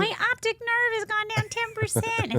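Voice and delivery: doctor delivering bad news, lightly voiced; mock doctor. Doctor quoted in retold drama.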